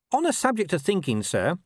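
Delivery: high-pitched underling voice; plunging in. A narrator's reading. Corporal seizing his chance with the captain, plunging in. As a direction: jump in eagerly with the boss. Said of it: "said Nobby, plunging in".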